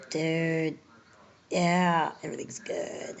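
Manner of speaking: drugged-up dude trailing into a whisper; mock stoner whisper. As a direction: whisper everything's good like you're out of it. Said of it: "It's like".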